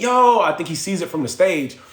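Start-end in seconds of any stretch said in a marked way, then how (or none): 0.0-0.4 loud, high call into the mic; friend yelling on mic. Retold or imitated.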